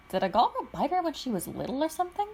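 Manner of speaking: a brother blurting a baffled guess; puzzled Matt. Acted. Matt asking Shiro, confused, about the princess.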